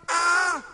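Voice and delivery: short loud mock scream "Ah". Shown as a demonstration. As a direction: scream it out for the kids to copy. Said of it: "schreien laut".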